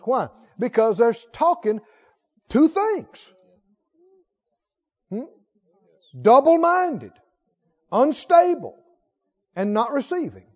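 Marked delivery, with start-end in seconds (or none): 2.5-8.8 higher, louder, each word flung out; emphatic proclamation. Real, not acted. Preacher warning the church, ringing and loud. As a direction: call the words out like a verdict.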